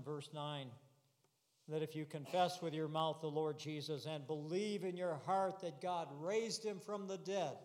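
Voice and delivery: ordinary delivery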